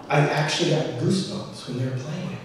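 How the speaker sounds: ordinary talk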